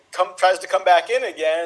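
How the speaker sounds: usual speaking voice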